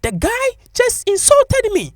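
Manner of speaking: very high, drawn-out falsetto complaint; indignant female customer. Retold or imitated. Customer reports the shop worker to her auntie, high mock indignation. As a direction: imitate her outrage in a slow, squeaky falsetto.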